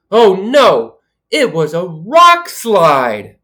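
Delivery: ordinary delivery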